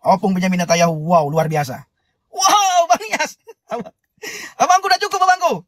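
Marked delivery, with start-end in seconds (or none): 2.3-5.5 high, delighted laughing thanks. Real, not acted. Viewer thanking a friend for a gift, laughing high.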